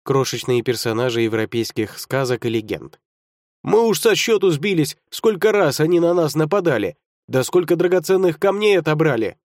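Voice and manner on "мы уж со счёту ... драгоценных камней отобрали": indignant gnomes. A narrator's reading. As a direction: complain like fed-up little gnomes. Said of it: "недовольные голоса".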